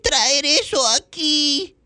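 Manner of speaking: very high, loud, squeaky whiny cartoon kid. Shown as a demonstration. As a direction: squeal it like a panicky cartoon boy. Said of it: "esa voz de ese personaje se parece mucho a la de Bob Esponja".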